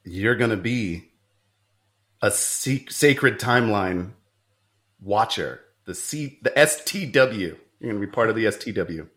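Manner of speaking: lightly voiced serious agent; low, grave secret agent; stern agent voice. Agent recruiting in a sketch.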